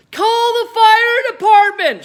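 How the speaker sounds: put-on high, loud panicked shriek from the old woman; sudden shout. A narrator's reading. Grandma screams for the fire brigade, loud shriek. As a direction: bellow it like the house is burning. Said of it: "she shouted suddenly".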